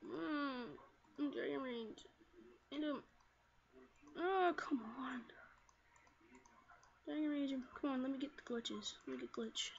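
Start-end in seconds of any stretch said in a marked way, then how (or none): none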